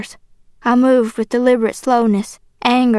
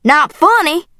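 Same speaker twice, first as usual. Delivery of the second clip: higher-pitched angry echo; incredulous yell; 6 semitones higher, 4 dB louder than usual. A narrator's reading. Father mocking girl's protest, incredulous yell. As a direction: yell it back in disbelief. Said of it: "he yelled".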